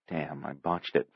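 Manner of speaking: soft, slow regretful aside; inner thought. A narrator's reading. Sister regrets her talk with brother, soft rueful thought. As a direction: murmur it to yourself. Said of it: "Penny thought".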